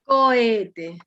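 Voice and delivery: cohete with o and e as separate vowels, co-e-te; correct hiatus. Shown as a demonstration. Teacher correcting a pupil, cohete said clearly as co-e-te. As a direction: say cohete drawn out in three syllables, co-E-te. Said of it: "Sino cohete".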